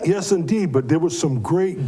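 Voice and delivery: ordinary talk, nothing marked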